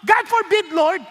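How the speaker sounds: loud exclamation, pushed up high; exclaimed rebuke. Retold or imitated. Peter rebuking Jesus, loudly exclaimed. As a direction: say it with an exclamation point. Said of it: "Exclamation point to".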